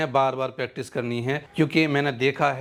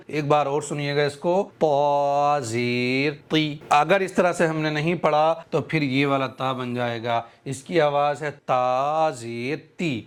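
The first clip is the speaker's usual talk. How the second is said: usual speaking voice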